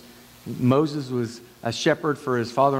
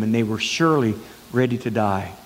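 usual speaking voice

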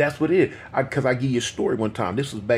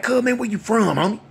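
gang challenge; 10 semitones higher than usual. Retold or imitated. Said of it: "So he like".

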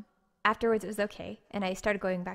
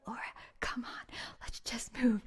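whispered plea; 6 dB quieter than usual. Retold or imitated. Friends pleading with Laura to move, whispered. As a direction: coax her away under your breath. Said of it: "they were like".

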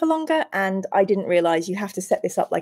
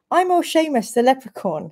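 higher-pitched stage-Irish leprechaun; hammed-up Irish accent for a little leprechaun; 5 semitones higher than usual. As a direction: introduce yourself in a dodgy Irish brogue. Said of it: "for that horrific accent".

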